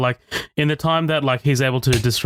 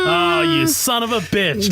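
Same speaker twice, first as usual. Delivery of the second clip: high, drawn-out real exclamation; exasperated outcry; 9 semitones higher, slower than usual. Real, not acted. Host hears friend's verdict on the film, exasperated outcry. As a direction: cry out in slow, high disbelief. Reacts to friend disliking the scene.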